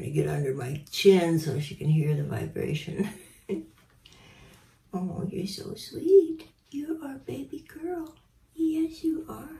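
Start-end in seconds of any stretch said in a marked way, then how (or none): 4.9-9.5 much higher, slow cooing to the bird. Real, not acted.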